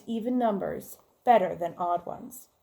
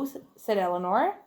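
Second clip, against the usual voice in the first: plain reading voice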